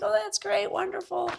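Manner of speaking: hammed-up chirpy reply; mock excited. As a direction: gush it in a bright put-on high voice. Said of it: "I'm like".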